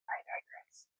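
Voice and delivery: whispered "I digress"; a hushed throwaway aside. Real, not acted. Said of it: "whispered aside".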